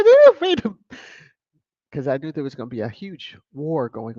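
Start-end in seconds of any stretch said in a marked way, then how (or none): 0.0-0.8 high, loud delighted ew; high excited squeal. Retold or imitated. Vlogger retelling reading the chat, high delighted squeal. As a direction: squeal it out gleefully. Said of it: "I said".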